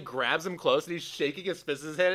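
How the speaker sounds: ordinary delivery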